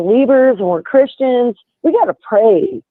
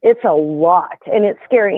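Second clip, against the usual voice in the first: ordinary delivery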